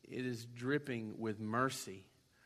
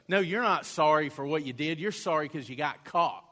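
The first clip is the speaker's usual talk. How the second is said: louder stern parent rebuke; mock parent scolding; 3 semitones higher, 7 dB louder than usual. Parent scolding a child for a fake apology, louder. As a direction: scold the child like a parent who sees through it. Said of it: "your parent look at you and go".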